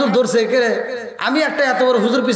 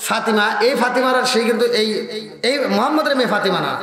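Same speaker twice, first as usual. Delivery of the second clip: ordinary talk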